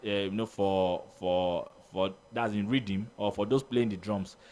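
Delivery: normal talking voice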